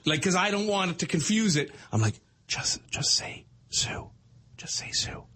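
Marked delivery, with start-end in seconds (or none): 2.5-5.2 slow, hushed stage whisper. Retold or imitated. Son secretly cues dad in his ear, slow whisper. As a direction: whisper a prompt into someone's ear. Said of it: "I'm trying to whisper in his ear".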